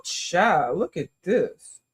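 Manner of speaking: normal talking voice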